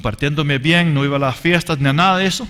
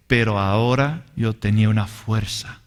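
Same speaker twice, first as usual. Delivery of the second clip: ordinary talk, nothing marked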